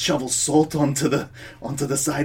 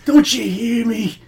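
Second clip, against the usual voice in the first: straining; 6 semitones higher than usual. Acted. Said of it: "It sounds like you're straining really hard when you speak".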